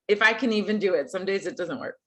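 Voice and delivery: usual speaking voice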